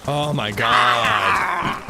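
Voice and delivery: long groaning disbelief; drawn-out oh my god. Real, not acted. A streamer stunned by a gunfight, drawn-out dismay. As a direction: stretch it out, stunned. Dismayed reaction during gameplay.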